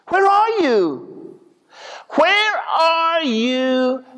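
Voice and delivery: high, slow, drawn-out cry to God; slow high lament; anguished plea. Retold or imitated. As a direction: plead upward, stretching each word. Crying out to God.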